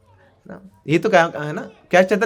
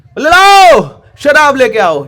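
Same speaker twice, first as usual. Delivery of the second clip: much higher, loud roar; ecstatic shouting; 7 semitones higher, 12 dB louder, slower than usual. Retold or imitated. Mahaprabhu in ecstasy shouting for wine, loud high roar. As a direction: bellow the order for wine. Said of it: "इतनी जोर जोर से बोल रहे हैं".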